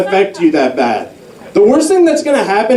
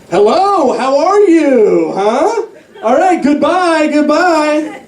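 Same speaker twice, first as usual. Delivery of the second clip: annoying office guy; 9 semitones higher, slower than usual. Retold or imitated. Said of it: "the annoying guy in the office is just like".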